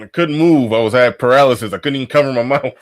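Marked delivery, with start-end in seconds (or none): none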